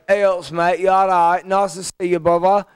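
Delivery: imitating a husband's broad Northern English accent; impression of a Huddersfield accent. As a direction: greet like a Yorkshire mate, northern English vowels. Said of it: "my husband's accent is like".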